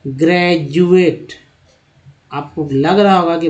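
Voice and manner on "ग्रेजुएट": ग्रेजुएट said slowly as "gre-jueT" with ज; slow demo of ग्रेजुएट with ज; with a J sound. Shown as a demonstration. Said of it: "चलिए अब ज का उच्चारण करते हैं".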